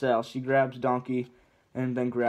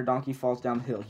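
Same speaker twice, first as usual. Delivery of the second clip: ordinary delivery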